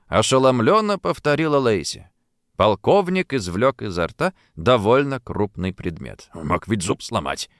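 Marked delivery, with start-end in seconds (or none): 6.3-7.5 lower, softer grumble; grumbling. A narrator's reading. Colonel grumbles about his near-broken tooth. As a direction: complain under your breath. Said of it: "проворчал он".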